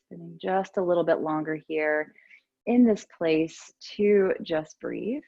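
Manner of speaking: unhurried, soothing cues with long pauses; guided meditation. Real, not acted. Yoga teacher settles class into breathing and stillness, calm guided meditation.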